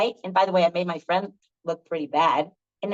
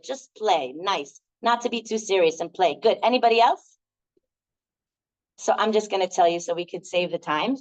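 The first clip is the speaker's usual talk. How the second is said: ordinary talk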